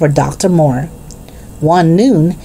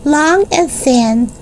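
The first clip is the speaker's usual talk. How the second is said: put-on slow, much higher, happy little-boy voice; happy child; 11 semitones higher, slower than usual. A narrator's reading. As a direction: draw out each word like a pleased child. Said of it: "said Benny happily".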